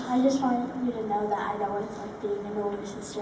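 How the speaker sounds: usual speaking voice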